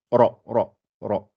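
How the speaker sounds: ra swelled and dark each time; ra repeated full and rounded; heavy ra. Shown as a demonstration. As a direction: always hollow the tongue for ra. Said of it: "je dois toujours la gonfler".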